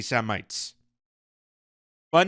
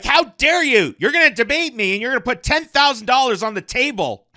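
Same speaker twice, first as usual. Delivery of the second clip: higher, louder indignant mock voice, quick; mock outrage; 6 semitones higher, 3 dB louder than usual. Commentator mocking the rabbi's debate challenge with hammed-up outrage. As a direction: ham up a pompous man's outrage.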